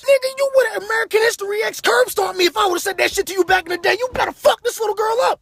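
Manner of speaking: high-pitched yelling rant; shrieking comic tirade; acted furious outburst. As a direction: scream it in outrage, very high.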